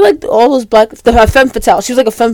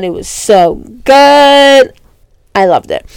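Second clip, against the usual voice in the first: gushing; 4 semitones higher, 4 dB louder, slower than usual. Real, not acted. Raving about the McFlurry.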